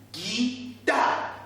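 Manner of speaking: "guitare" as a long ghee, pause, long tar; syllable by syllable. Shown as a demonstration. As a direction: stretch each beat of "guitare" for learners. Teacher repeats word in syllables.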